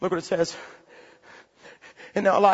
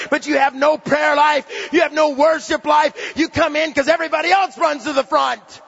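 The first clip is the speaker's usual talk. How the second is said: full-force preaching; 4 semitones higher, 5 dB louder than usual. Real, not acted. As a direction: pound each charge into the crowd.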